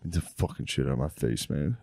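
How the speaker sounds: low, slurred angry patient; mimicking drugged low growl. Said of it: "So you were just like".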